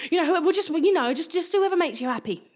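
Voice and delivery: high, loud flustered nan; imitating nan. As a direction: play a nan stumbling through her support. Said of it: "She was like".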